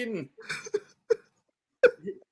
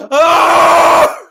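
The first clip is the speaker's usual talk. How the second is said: loud howl of grief; anguished cry; 6 semitones higher, 9 dB louder than usual. Acted. Father howling over his dead son. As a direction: cry out in despair.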